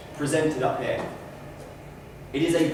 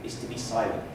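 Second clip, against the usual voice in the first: quiet; 6 semitones lower, 8 dB quieter than usual. Shown as a demonstration. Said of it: "the best way to emphasize it".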